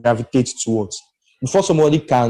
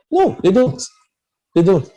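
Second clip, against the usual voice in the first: high, insistent denial; emphatic rebuttal; 7 semitones higher, 3 dB louder than usual. Real, not acted. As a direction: cut in with a firm, raised objection. Rebutting a quoted belief.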